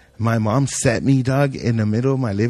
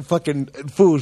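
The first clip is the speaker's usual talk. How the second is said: ordinary talk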